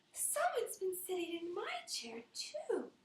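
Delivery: high pitched mama bear. Acted. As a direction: squeak it like a startled mother bear.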